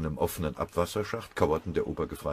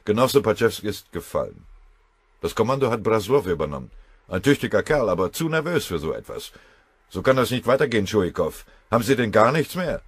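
loud, higher hard-pressing officer; raised commanding voice; 6 semitones higher, 9 dB louder than usual. Acted. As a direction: hammer the general with bad news. Kriegsrat drängt Tschuikow.

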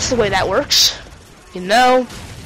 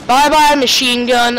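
normal talking voice

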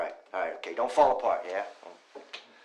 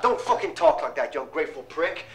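ordinary talk, nothing marked

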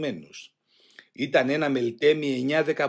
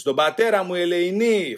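raised, higher pleading cry; shouted protest; 5 semitones higher, 5 dB louder than usual. A narrator's reading. Son shouting at guards beating his father, raised anguished cry. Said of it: "φώναζε ένας".

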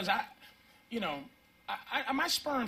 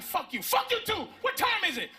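cursing shout; 10 semitones higher than usual. Retold or imitated. Customer cursing at cashier.

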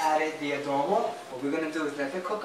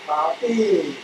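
playful reply; 7 dB louder than usual. Pretend. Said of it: "I'm like".

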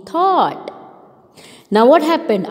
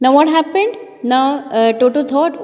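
ordinary delivery

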